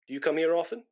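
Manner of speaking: ordinary talk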